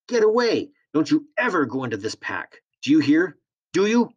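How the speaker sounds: high, loud furious yell. Acted. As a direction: scream at him to get away. Woman furious about her pack.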